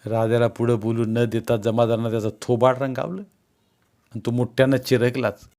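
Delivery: usual speaking voice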